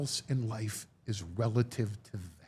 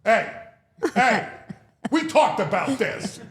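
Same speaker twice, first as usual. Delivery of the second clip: louder, higher playful scolding; mock scolding kid; 9 semitones higher, 7 dB louder, slower than usual. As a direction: tease like a kid scolding his parents. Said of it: "the kid goes like this".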